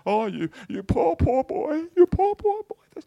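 high, slow cooing pity, fading to a whisper; overdone syrupy sympathy; mock pity. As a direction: ham up a pitying wife over a poor boy. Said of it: "my little wife didn't go".

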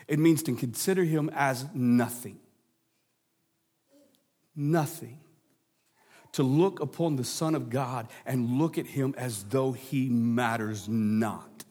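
hushed emphasis. Real, not acted. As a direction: drop to a near-hush, slow and soft. Preacher hushes on key point.

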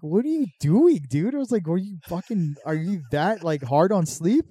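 high, loud disbelieving cry; shocked friend yelling at mom over the gummies; incredulous outburst. Retold or imitated. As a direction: blurt it out in shock.

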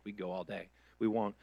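ordinary talk, nothing marked

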